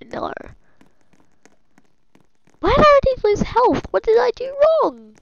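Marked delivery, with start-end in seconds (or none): 2.6-5.2 high, loud protest; indignant complaint. Real, not acted. Player losing health without reason, loud indignant wail. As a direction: cry out at the unfairness.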